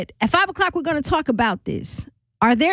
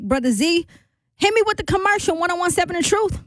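high, quick station call-out; upbeat DJ sign-off to break; radio break throw; 7 semitones higher, faster than usual. Real, not acted. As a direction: toss it to the commercial, pitched up and fast. Host throws to commercial break.

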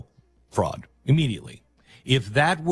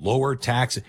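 ordinary talk, nothing marked

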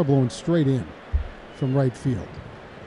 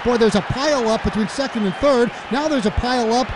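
excited play-by-play; 7 semitones higher than usual. Real, not acted. Radio announcer to listeners, calling the messy play, excited rising shout. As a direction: let it build into a near-shout as the runners get caught. Live call of botched double play.

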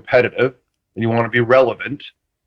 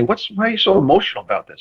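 curious question; 8 semitones higher, faster than usual. Retold or imitated. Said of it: "I asked Ronnie".

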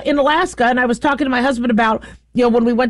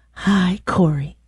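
put-on lower, slow Southern-accented greeting; a sweet Southern belle saying hi, slowly and lower; Southern AI girlfriend; 6 semitones lower, slower than usual. AI companion greeting her husband, lower slow Southern accent. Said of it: "he'd probably like the Southern accent".

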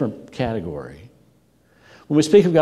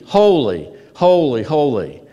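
raised, falling calls; repeated proclamation; 3 semitones higher, 4 dB louder, slower than usual. Acted. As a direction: echo the cry back. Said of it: "Then it would repeat".

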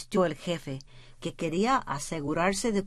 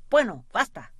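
sharp, louder cut-off; impatient interruption; 4 semitones higher, 5 dB louder than usual. Acted. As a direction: shut him down, impatient. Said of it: "interrumpió el jefe".

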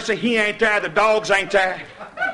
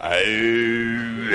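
hound call; 10 semitones lower than usual. Retold or imitated. Imitating hounds on the trail.

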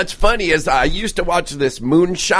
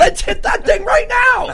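excited shout. Real, not acted. Excited about the vape.

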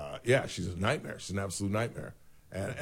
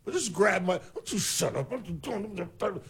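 higher, scolding imagined parent; hammed-up outraged parent to cop; lightly voiced indignant mother; mock parent; 8 semitones higher than usual. Voicing imagined parent's reaction.

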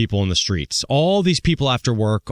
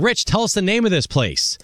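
higher-pitched pretend yell, no real volume; mock yelling; 8 semitones higher than usual. Listener demands the pub's name from the host, mock yell. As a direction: imitate an exasperated listener yelling at the radio. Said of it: "yelling at your speaker".

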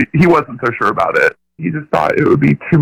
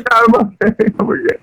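high laughing reassurance; giggling through nerves; nervous laugh; 9 semitones higher than usual. Real, not acted. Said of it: "are you sure you're okay".

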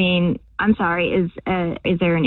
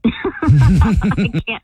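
laughing; 4 dB louder than usual. Real, not acted. Laughing after prank reveal.

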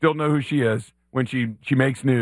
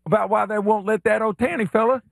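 Billy Payne; 6 semitones higher than usual. Acted. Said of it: "as Billy Payne?".

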